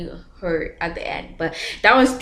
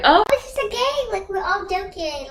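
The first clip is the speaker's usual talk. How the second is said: high, breezy mock-innocent voice; much higher, carefree imitation of her younger self; carefree inner voice; 12 semitones higher than usual. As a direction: play it like it's all a harmless game. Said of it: "I was thinking like".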